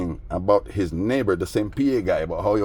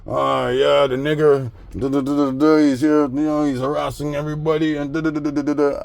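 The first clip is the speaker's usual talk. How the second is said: higher, louder gibberish parody; mock babbling neighbor; 7 semitones higher, 3 dB louder than usual. Mocking the wallet thief accusing him, high babbling. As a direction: babble like a fool who won't stop.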